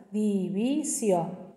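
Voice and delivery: "división" slowly, a pause between each syllable; split into syllables. Shown as a demonstration. Teacher dividing a word for pupils, syllable by syllable. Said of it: "Divido en sílabas".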